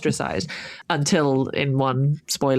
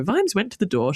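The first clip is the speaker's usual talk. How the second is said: ordinary delivery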